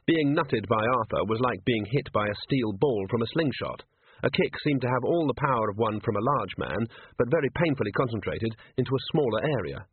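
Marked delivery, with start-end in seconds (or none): none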